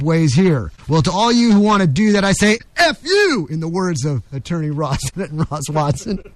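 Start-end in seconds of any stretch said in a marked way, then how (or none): 2.8-3.5 F you flung out high and hard; emphatic F you. Real, not acted. Host telling unwanted newcomers off on air, emphatic. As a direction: spit the F you out defiantly.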